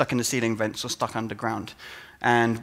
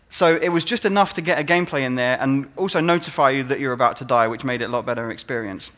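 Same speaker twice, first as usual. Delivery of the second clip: ordinary talk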